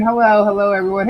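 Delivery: ordinary delivery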